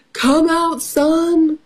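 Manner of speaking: high, mild calling-out; storybook shout. A narrator's reading. Storyteller as Cow behind the barn, high mild shout. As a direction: call the sun out, pitched high without real volume. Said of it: "shouted Cow".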